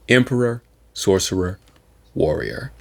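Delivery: ordinary delivery, nothing marked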